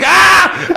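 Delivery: high, loud laughing yell; a high, laughing shout of joy; joyful shout. Retold or imitated. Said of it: "And I'm like".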